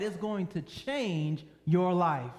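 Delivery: normal talking voice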